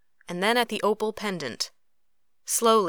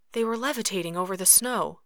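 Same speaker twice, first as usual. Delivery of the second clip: usual speaking voice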